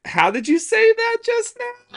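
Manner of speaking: squeaky teasing question; mock quizzing. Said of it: "And I'd be like".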